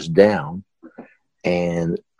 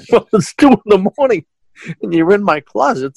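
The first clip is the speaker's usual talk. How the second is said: high-pitched words through laughter; laughing reply; 13 semitones higher than usual. Real, not acted. Host telling an intruder he's caught, laughing. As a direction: crack up while retelling your own comeback.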